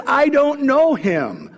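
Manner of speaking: high, slow, emphatic protest; vehement denial. Acted. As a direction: deny it with force. Peter's final denial, cursing.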